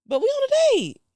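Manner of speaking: high, loud scolding on a date; exasperated outburst. Retold or imitated. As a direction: snap it at him, fed up.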